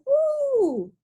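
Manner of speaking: high whooping cheer; joyful woo. Acted. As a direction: whoop like you're flying off. Leaves soaring away.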